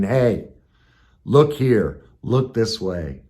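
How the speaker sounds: loud call, slowing on the last words; a nudging inner voice calling for attention. Pretend. Inner voice calling him to look, loud then slow.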